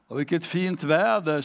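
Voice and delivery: normal talking voice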